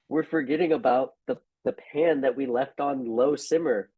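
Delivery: remembering the pan in a panic; sudden alarm. Real, not acted. Sudden alarm about simmering pan.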